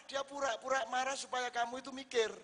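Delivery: usual speaking voice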